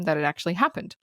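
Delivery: ordinary talk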